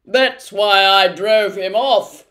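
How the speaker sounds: loud, slow blustering humbug; fierce cry. Acted. The humbug brags to Milo, loud and fierce. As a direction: boast with mock bravery. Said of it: "cried the humbug, fiercely".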